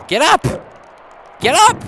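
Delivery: high, loud shout of encouragement; loud urging shout. Real, not acted. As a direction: yell at the fighter to get up. Urging his wrestler.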